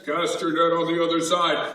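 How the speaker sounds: a hint of a divine voice; mysterious voice. Peter imitating the voice telling him to cast. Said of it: "I hear this voice that says".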